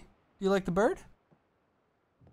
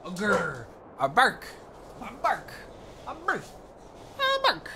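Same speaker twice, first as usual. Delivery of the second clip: playful spoken barks climbing higher and higher; mock dog bark; 5 semitones higher than usual. Player to her dog, playful mock barking. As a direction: say 'bark' as a dog, pitch leaping up each time.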